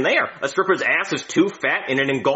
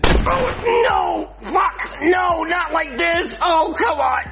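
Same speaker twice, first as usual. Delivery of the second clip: anguished wailing; 11 semitones higher, much slower than usual. Acted. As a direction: wail at cruel fate. Despairing at dying wrong way.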